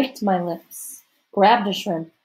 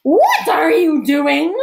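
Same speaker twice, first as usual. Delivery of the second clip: loud, drawn-out angry growl; stern, high growled demand; 8 semitones higher, 9 dB louder, slower than usual. A narrator's reading. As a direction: growl it slowly and loudly, pitched up. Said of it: "she growled".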